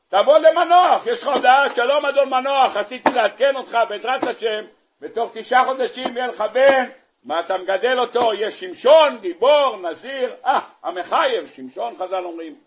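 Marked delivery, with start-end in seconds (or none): 0.1-10.3 mock angel announcement. Angel announces son to Manoah.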